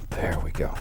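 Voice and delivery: breathy whispered aside. Real, not acted. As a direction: whisper it to yourself. Whispered aside while painting.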